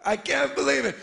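ordinary talk

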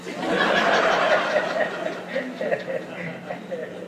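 long soft laughter at himself; long quiet laugh. Real, not acted. Laughing at shoulder injury.

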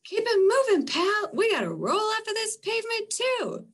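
high, loud, fast and bossy; pushy street tough. Acted. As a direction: push the little raindrop along, impatient. Pushy character shoving the raindrop.

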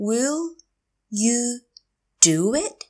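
Will, you, do it, with long gaps; slow teacher model of "Will you do it"; word by word. Shown as a demonstration. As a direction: pause after "Will" and after "you". Teacher repeats phrase word by word.

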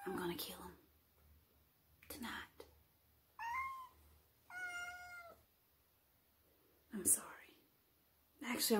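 hushed, creepy murderer's whisper; sinister whisper. Acted. Confessing to camera about killing the cat, in a sinister whisper. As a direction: breathe it out like a deadly secret.

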